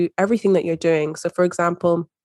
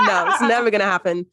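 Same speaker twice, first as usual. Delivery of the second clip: higher-pitched, amused naysayer through laughter; laughing mock doubt; 4 semitones higher than usual. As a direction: laugh while playing the doubting inner voice. Joking as the inner doubting voice.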